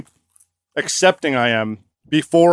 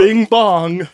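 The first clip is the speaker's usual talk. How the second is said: put-on, higher-pitched fake accent; deliberately off mock-Chinese accent; hammed-up joke accent; 4 semitones higher than usual. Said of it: "Chinese accent".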